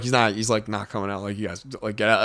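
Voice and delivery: soft, low dismissal; hushed, firm brush-off; quiet warning. Retold or imitated. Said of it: "Jamie would go outside and be like".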